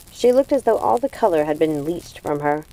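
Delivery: ordinary delivery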